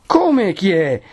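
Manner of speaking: high-pitched indignant question back; incredulous exclamation. Acted. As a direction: throw the question back, astonished. Bell-ringer exclaims back at the crowd. (esclamazione incredula, acuta)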